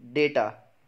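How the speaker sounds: "डेटा" as loud DAY-taa; correct pronunciation. Shown as a demonstration. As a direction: hit "day" in "डेटा", falling end. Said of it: "क्या बोलते हैं?".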